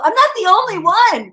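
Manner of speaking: high, loud, hammed-up self-defence; mock protest. Parent caught crying defends herself, loud mock protest. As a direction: protest it loudly, half laughing. Acts out protest to teasing child.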